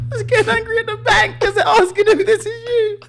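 high wail through real laughter; high, helpless, half-crying with laughter; laughing to tears. Real, not acted. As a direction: plead through a laughing fit. Cracking up at own misfortune.

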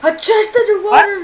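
high, loud and slow; thrilled shout at a find; excited. Real, not acted. Spotting a chest, thrilled.

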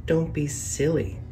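plain reading voice

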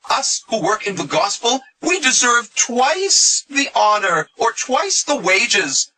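high, slow mocking voice; Paul. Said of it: "Paul says".

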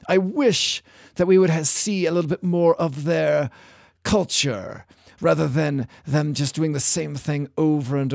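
put-on a passable Hugh Grant-style English accent, mildly done. Host doing a lightly voiced English accent as Liam. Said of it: "I'm gonna do a Liam impression".

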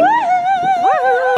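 long, high sung-out "woohoo"; excited whoop. Real, not acted. Vlogger off to croissant making, excited high whoop. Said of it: "Very excited".

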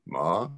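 "ma" rising at the end; question tone. Shown as a demonstration. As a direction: ask "ma?" like a question. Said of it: "you got the question tone".

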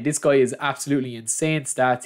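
ordinary delivery

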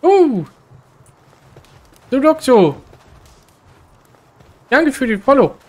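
high, loud happy greeting; excited thanks. Real, not acted. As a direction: cheer a new follower. Thanks a new follower.

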